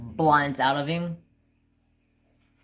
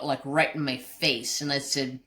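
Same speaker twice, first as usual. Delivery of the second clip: ordinary delivery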